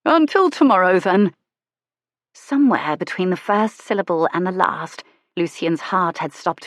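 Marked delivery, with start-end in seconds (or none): 0.0-1.3 shopkeeper's greeting. Acted. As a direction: call out a friendly good day. Shopkeeper calls after her.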